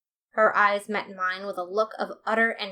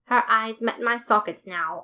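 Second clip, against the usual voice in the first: ordinary delivery, nothing marked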